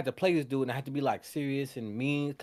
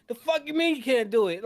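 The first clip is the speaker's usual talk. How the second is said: higher, louder imitation of an angry enforcer; mock angry demand; 9 semitones higher, 5 dB louder than usual. Fan imitating Smoke ordering Preacher Boy, mock angry. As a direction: bark the order like an impatient big brother.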